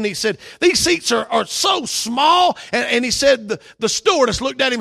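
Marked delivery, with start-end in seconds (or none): 0.6-2.6 higher, louder whine stretching out "so small"; imitating a grumpy passenger's gripe; griping complaint. Tired passenger complaining about tiny seats, mock whine. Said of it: "griping and complaining".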